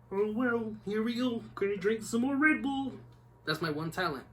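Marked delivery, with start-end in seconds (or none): 0.1-2.9 high, nasal frog puppet voice, lightly done; Kermit the Frog voice. Pretend. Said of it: "I could do the Kermit voice".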